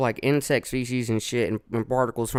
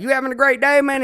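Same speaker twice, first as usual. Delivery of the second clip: much higher, louder chirpy greeting; mock cheerful greeting; 13 semitones higher, 6 dB louder than usual. Host imitating his cheery greeting to coworkers. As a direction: sing out a fake-happy hello. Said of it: "When I be saying some shit like".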